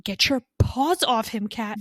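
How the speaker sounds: a fan shouting at a rival character, fired up; mock angry. Real, not acted. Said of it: "My blood is fucking boiling".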